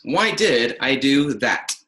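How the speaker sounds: slow, each word weighted, hard T on "that"; definitive demand. Shown as a demonstration. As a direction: demand an answer with a crisp final T in "that". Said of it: "That hard sibilance on the T".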